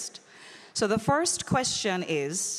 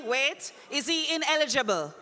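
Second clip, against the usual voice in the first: ordinary delivery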